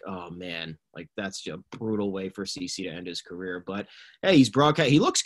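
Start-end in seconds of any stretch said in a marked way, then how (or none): 0.1-3.6 low, soft dejected reaction; deflated. Retold or imitated. Fan watching it all collapse, quietly deflated. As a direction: let it sink, quietly let down.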